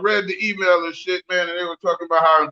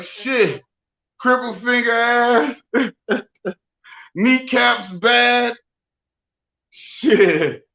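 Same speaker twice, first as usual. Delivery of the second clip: worn-out wheeze; 4 semitones higher, much slower than usual. Retold or imitated. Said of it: "I'm in there out of breath".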